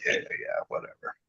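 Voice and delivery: mock brush-off, low-volume and throwaway; dismissive. Retelling an inner reaction to a compliment, quietly dismissive. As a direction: toss it off under the voice.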